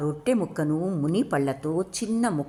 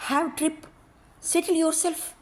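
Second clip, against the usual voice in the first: coaxing; 10 semitones higher, faster than usual. Acted. Mary coaxing Sabari to smoke.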